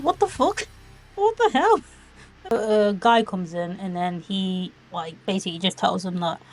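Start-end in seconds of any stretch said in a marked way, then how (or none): 0.0-1.8 high, rising cries of disbelief; stunned, pitched way up and a bit louder; shocked disbelief. Retold or imitated. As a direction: react like you can't believe what you're seeing. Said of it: "I'm like".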